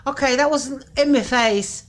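normal talking voice

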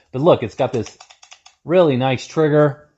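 ordinary delivery

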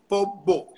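"pombo" with a held b sound; Cuban accent polvo. Shown as a demonstration. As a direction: stretch the b in "pombo". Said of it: "ese V sostenida". ("pombo" con la V sostenida)

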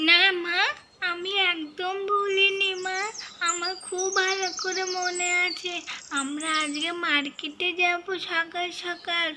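put-on small scared kid crying out for her mother; Mahi. Child calling out to her missing mother after a nightmare.

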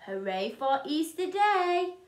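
usual speaking voice

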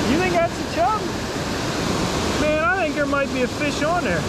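eager and hopeful; excited. Real, not acted. Excited about a chub.

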